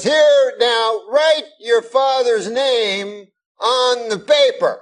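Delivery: high, very slow mock Cosby instructions; Bill Cosby impression. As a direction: draw each word out high like Cosby. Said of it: "gets a paper and he goes".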